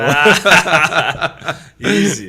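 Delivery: loud, laughing brush-off; laughing boast. Real, not acted. [name] laughing loudly, bragging that wrapping the car is easy.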